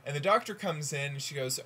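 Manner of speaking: ordinary talk, nothing marked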